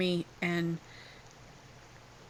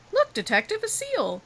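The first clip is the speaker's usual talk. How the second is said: high, louder excited call; excited pointing-out; 13 semitones higher, 4 dB louder than usual. Acted. Game figure spots a seal.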